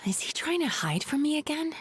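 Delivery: hushed, private suspicion; whispering her doubt about the counselor; suspicious whisper. Acted. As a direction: whisper it to yourself, wary. Muttering to herself, suspicious.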